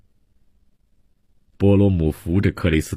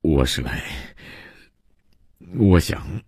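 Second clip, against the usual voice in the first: halting, breathless and faint, with long pauses; weak stammer; 2 semitones lower, 4 dB quieter than usual. Acted. Exhausted guest haltingly asks to be taken in, weak stammer. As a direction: stammer it out softly, voice nearly giving out. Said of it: "他结结巴巴地想说明来意".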